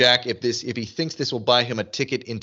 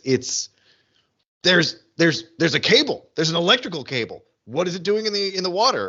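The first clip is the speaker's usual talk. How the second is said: higher, stammering disbelief; incredulous excitement; 6 semitones higher than usual. Retold or imitated. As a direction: puzzle aloud in rising amazement. Re-living amazement at the cable.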